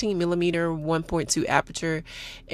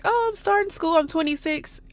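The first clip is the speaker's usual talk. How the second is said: mock young student; 10 semitones higher than usual. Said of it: "I know y'all be like".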